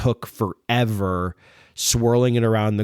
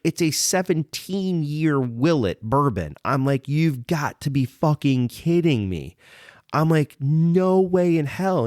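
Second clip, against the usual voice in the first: higher, slow, drawn-out retold disbelief; incredulous protest; 6 semitones higher than usual. Customer refusing a precious bourbon pour, high slow incredulous retelling. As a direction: stretch out the stunned refusal, pitched up.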